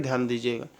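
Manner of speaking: normal talking voice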